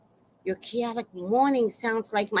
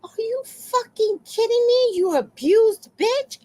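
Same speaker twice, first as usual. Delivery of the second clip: much higher, indignant squeal, drawn out; outraged high voice; 14 semitones higher, 3 dB louder, slower than usual. Retold or imitated. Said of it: "And I'm like".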